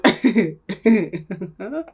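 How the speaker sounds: real, unforced giggling; laugh. Real, not acted. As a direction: break into a chuckle mid-thought. Laughs mid-rant about deal breakers.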